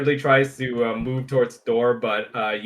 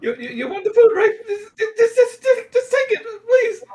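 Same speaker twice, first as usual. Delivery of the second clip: very high, louder, stammering sob; sobbing plea; 6 dB louder than usual. Acted. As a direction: whimper and stutter, begging him to take it. Beaten shopkeeper begs the attacker.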